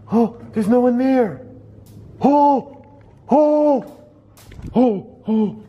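high, alarmed oh-oh-oh; panicked little cries; frightened yelps. Real, not acted. Frightened reaction to unexplained event.

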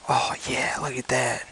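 soft admiring whisper; whispered delight. Real, not acted. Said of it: "I'm talking a little quiet".

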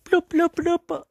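very high, loud squeaky blooping; high squeaky creature noises; cartoon bloop. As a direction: bloop like a tiny cartoon critter. Cartoon creature sound effect.

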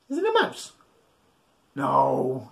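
usual speaking voice

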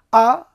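আ pressed out from the middle of the throat. Shown as a demonstration. Teacher models the ayn sound for the class. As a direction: squeeze আ from the throat. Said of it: "কণ্ঠনালীর মাঝখান থেকে চেপে চেপে".

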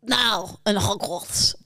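slow, low professor with long pauses; Severus Snape. Shown as a demonstration. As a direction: draw out each phrase like a stern potions master. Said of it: "Ready for this one?".

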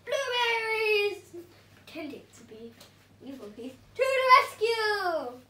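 hammed-up hero cry; heroic announcement. A narrator's reading. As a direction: trumpet it like a cartoon hero.

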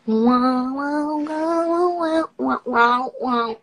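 high, loud, delighted repeated wows; gushing wows. Real, not acted. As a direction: gush over it like a fan. Sees something delightful, gushes.

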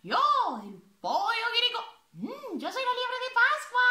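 high cheerful animal voices; squeaky little animal characters. Acted. Child animals introduce themselves.

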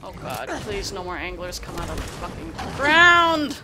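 panicked loud plea; terrified horror victim. Real, not acted. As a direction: beg in real panic. Swarmed by anglers, loud plea.

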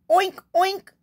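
high oink oink; pig oinking. Acted. Pig asking the librarian for something, oinking. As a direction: squeal it like a little pig. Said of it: "all the librarian heard was".

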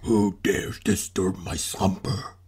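slow, low, soft monster rumble; a sleepy sea beast rising from the deep. Acted. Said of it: "I'm a scary sea monster".

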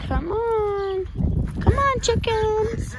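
high loud coaxing call; calling chickens. Real, not acted.

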